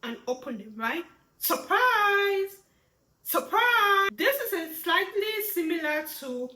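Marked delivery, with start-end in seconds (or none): 1.4-2.6 loud, high drawn-out exclamation; surprise. Shown as a demonstration. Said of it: "wake up has less emotions to it". 3.2-4.1 loud, high drawn-out exclamation; surprise. Shown as a demonstration. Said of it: "wake up has less emotions to it".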